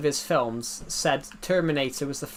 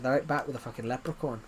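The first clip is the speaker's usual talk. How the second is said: softer mock Irish comeback; lightly put-on Irish accent; 2 semitones lower, 7 dB quieter than usual. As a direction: throw it away in an Irish accent. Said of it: "I did the Irish accent back".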